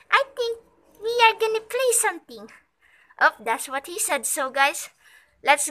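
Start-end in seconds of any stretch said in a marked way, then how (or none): 0.1-2.5 dog's voice. Pretend. Voicing the dog's answer.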